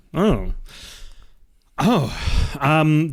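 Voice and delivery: ordinary talk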